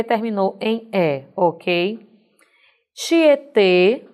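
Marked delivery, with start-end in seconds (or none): 2.9-4.1 TIETÊ with a closed final ê, louder; closed E sound. Shown as a demonstration. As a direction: demonstrate the closed ê ending of Tietê. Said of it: "uma pronúncia de um som fechado".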